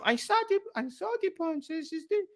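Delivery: hammed-up impression of the takeout cashier; imitating the Chinese restaurant lady. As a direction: put on a high little voice for the shop owner. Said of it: "la china me dijo".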